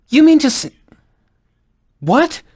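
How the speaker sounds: ordinary talk, nothing marked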